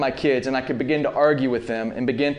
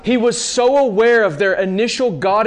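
impassioned preaching; 8 semitones higher, 3 dB louder than usual. Real, not acted. Preacher presses the question at full force.

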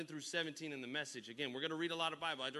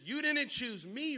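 preaching; 8 semitones higher, 5 dB louder, slower than usual. Acted. Preached scripture, loud rise.

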